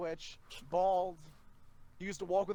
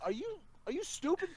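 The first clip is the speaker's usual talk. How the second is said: incredulous coworker; 12 semitones higher than usual. Retold or imitated. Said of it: "he's like".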